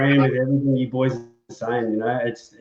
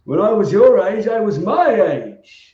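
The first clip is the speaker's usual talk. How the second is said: put-on higher, slow parody of an old man's cliché; mock old-timer; 7 semitones higher than usual. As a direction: play the grumpy elder, then drop the punchline. Joking parody of old-timer line.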